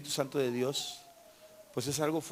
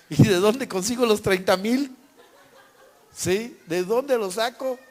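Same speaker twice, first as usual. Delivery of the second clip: high-pitched, louder mock disbelief; exasperated protest; 6 semitones higher, 6 dB louder than usual. Husband asking wife where thirty thousand comes from, exasperated. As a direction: replay a flustered protest, pitch jumping up.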